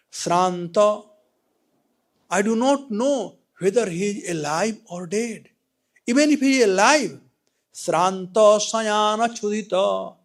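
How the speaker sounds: loud, rising fearful lament; anguished king. Acted. Preacher voicing the king's fear for his son, anguished. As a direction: cry out in worry.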